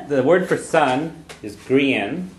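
grian spoken clearly as kree-un after a slow lead-in; teacher's Irish model. Shown as a demonstration. Teacher introduces the Irish word for sun, slow. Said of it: "the other one".

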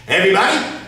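usual speaking voice

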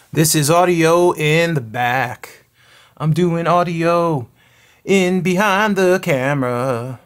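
loud, slow projected announcement; calling out. Real, not acted. Testing audio from behind the camera, calling out slowly.

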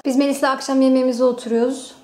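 normal talking voice

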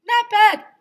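put-on very high, loud kid voice; surprised Ryan. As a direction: announce it tastes fine.

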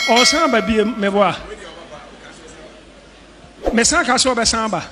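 usual speaking voice

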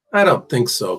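usual speaking voice

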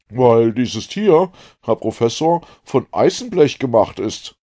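loud, high, weighty revelation; Ned. A narrator's reading. Ned reveals the truth to the professor, emphatic loud voice.

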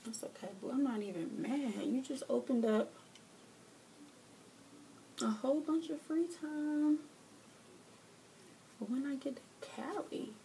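very soft, wounded-but-fine murmur; hushed, falling to a whisper at the end; feigned calm. Real, not acted. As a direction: drop suddenly to a hushed voice, insisting it's fine. Feigns calm after the outburst.

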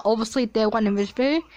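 rushed, words tumbling out very fast; talking fast. Real, not acted. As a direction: race through it without pausing. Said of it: "I don't know why I'm talking so fast".

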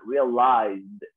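Realized said slowly with a clear D sound at the end; pronunciación: Realized con una D final clara. Shown as a demonstration. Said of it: "se pronuncia como con una D al final".

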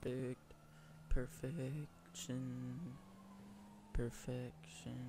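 slow, stretched gloating; drawn-out savoring. Real, not acted. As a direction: stretch the word out with pride. Savoring a perfect move.

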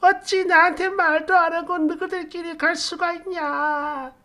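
high-pitched imitation of a sobbing mother-in-law; hammed-up tearful reproach; mock wailing. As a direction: wail it out, sustained high, mimicking her. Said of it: "이 할머니가 엉엉 울면서".

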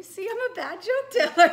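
high, loud, laughing admission; amused at your own failure; laughing self-mockery. Real, not acted. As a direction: break into laughter as you own up. Laughing at own failed joke.

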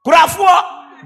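put-on loud, high call to the boy. Preacher calls his little rival, loud call. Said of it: "I say".

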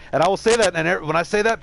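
ordinary delivery

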